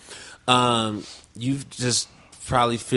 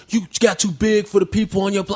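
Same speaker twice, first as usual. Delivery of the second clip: high-pitched mock indignation; put-on upset voice, much higher; upset friend; 7 semitones higher than usual. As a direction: imitate an aggrieved friend's complaint, pitched up. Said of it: "He got upset on text".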